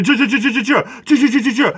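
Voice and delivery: high, machine-gun stuttered chills; mocking mimicry. Imitating the pastor shushing the woman, mocking. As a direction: parody the pastor's repeated shushing.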